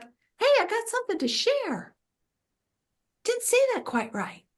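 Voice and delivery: very high, small put-on voice. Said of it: "It's not offensive. The inner teacher's like".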